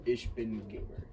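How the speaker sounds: a clipped German Ich bin Gamer; German accent. Shown as a demonstration. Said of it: "Can you do, like, a German accent".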